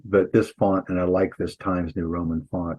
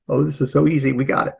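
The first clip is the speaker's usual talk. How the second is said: higher, breezy student reaction; mock easy confidence; 6 semitones higher, faster than usual. Teacher voicing students who find the punctuation easy, mock confidence. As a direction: play the student who thinks it's easy.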